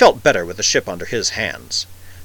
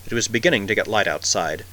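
plain reading voice

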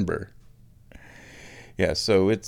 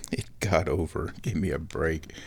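self-mocking grumble; 6 semitones lower, 6 dB quieter than usual. Real, not acted. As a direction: lower and softer, muttered under his breath. Grumbling at his own phrasing.

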